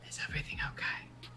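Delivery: sly, hushed check-in; whisper. Acted. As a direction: whisper it through a door held ajar. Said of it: "he kind of whispers".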